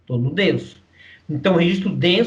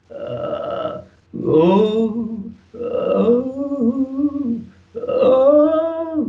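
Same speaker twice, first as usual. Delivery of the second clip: pop vocal onset from fry; 8 semitones higher, much slower than usual. Shown as a demonstration. Teacher demonstrates how pop singing starts. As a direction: slide from creak up to a full high oh. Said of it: "que geralmente a música pop começa".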